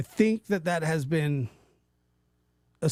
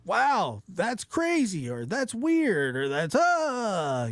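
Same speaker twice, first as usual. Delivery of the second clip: mock astonishment; 6 semitones higher, slower than usual. Shown as a demonstration. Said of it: "I use these adjectives sometimes".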